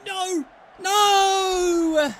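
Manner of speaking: high, loud cry of no; anguished shout. Real, not acted. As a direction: yell no in dread. Opponent chance, shouting no.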